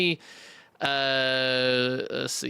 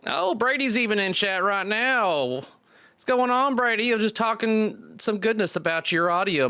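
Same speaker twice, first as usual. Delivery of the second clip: excited greeting; 10 semitones higher than usual. Real, not acted. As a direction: greet a friend who just showed up. Greeting chat guest excitedly.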